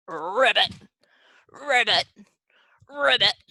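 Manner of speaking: loud rolled frog ribbits; frog croak. Pretend. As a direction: croak loudly like a jumping frog. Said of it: "Can you be like a frog?".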